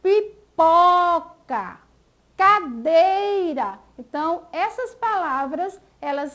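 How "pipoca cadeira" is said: pipoca, cadeira slowly by syllables, po and dei stressed; stressed middle syllables. Shown as a demonstration. Teacher showing tonic syllables, slow pipoca, cadeira stressed on po and dei. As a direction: draw out both words, punching po in pipoca and dei in cadeira. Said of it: "elas são pronunciadas com mais força".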